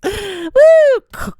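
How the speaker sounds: high, loud "woo" after a gasp; excited whoop. Acted. Woman acting out her jump down, gleeful whoop. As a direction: whoop as if leaping off.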